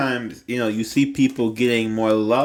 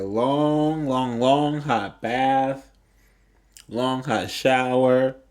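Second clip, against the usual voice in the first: drawn-out long; much slower than usual. Real, not acted. Soothing self-care advice, stretched words.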